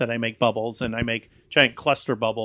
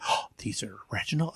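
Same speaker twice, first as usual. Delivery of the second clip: whispering; 3 semitones lower, 9 dB quieter, faster than usual. Retold or imitated. Adults asking, surprised.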